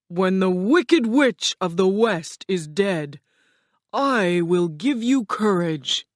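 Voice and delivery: grand, forceful put-on wizard voice; commanding wizard. Wizard sets the lion his task, grand commanding voice. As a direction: lay down terms as the mighty Oz.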